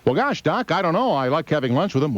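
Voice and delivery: high, innocent caller voice; a lightly voiced caller answering the radio doctor; naive caller. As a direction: play the clueless guy on the phone.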